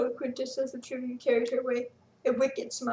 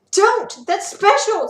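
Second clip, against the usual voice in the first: loud high plea; yelled; 8 semitones higher, 13 dB louder, much slower than usual. A narrator's reading. Sarah yelling at the tribune taking her necklace. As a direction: yell to stop him. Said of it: "Sarah yelled".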